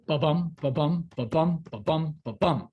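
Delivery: ba-BUM, stress on each second syllable, evenly repeated; heartbeat rhythm. Shown as a demonstration. Teacher showing students an iamb's beat, heartbeat rhythm. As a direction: chant "Ba-bum" like a steady heartbeat. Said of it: "It's like a heartbeat".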